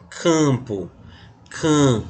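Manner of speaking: "campo" slowed with the "cã" vowel drawn through the nose; slow "campo", then "cã" on its own, showing the nasal vowel; nasal vowel demo. Shown as a demonstration. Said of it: "Aqui ele nasalizou".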